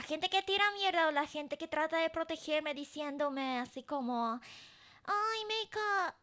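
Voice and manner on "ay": usual speaking voice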